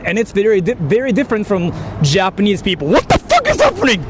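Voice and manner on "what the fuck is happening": high, stunned what the fuck; real shock, voice leaping up; shocked outburst. Real, not acted. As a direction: blurt it out in disbelief, high up. Shocked at reading the comment.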